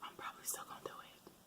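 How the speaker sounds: quick hushed confession; whispering. Real, not acted. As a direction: whisper it, a bit guilty. Confessing aside.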